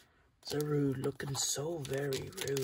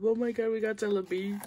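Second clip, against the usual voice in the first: high, loud delight; real excitement, high and loud; thrilled; 7 semitones higher, 7 dB louder than usual. Real, not acted. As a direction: gush over the card. Still thrilled at the pull.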